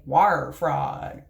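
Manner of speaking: Appalachian "Wire fraud" with the R rolled thick and low; Appalachian accent. Shown as a demonstration. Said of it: "in my Appalachian accent say".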